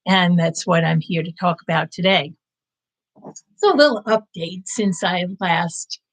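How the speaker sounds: usual speaking voice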